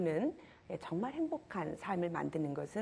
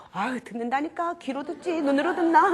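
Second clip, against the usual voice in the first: higher and louder irritated snap; annoyed husband; 8 semitones higher, 7 dB louder than usual. Retold or imitated. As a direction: brush off the nagging wife. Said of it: "막 이러잖아요". (남편이 아내에게 짜증스럽게 받아치기)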